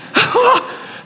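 a burst of high, happy laughter; delighted high laugh. Real, not acted. Preacher recalls his own change, laughing with joy. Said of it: "I was so excited".

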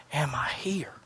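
hushed, unvoiced question; whispered question. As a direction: barely breathe the doubt.

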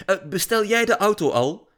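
high, loud call on the run. A narrator's reading. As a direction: shout it back to another room. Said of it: "riep Lily".

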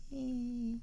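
high, thin witchy squeal; a quick mock cackle from the old woman down the road; short high-pitched witch screech; witch shriek. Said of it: "crazy little woman down the road that says".

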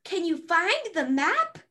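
lightly voiced little explorer, asking; Dora impression. Shown as a demonstration. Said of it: "And then you can say".

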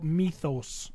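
mythos with a long "ee", MEE-thohs; imitated pronunciation. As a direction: say mythos as MEE-thohs, copying his co-host. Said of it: "You say mythos".

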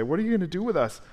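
high, panicked question; panicked plea. Acted. Demons at Jesus' feet, high and panicked. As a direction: beg for mercy in a rush. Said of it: "they're so panicked".

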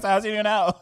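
high, loud question through laughter; laughing blunt question. Retold or imitated. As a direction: blurt it out laughing. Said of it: "I was like".

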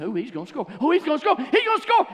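rising fan shouting at a play; building excitement. Retold or imitated. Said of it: "I, I said".

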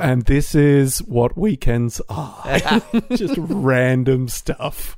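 slow. Real, not acted. Slow drawn-out remark.

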